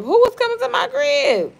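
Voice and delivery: very high, loud shouted question; outraged yell. Real, not acted. Streamer challenges claim about a visitor, outraged. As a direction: shriek it in real disbelief.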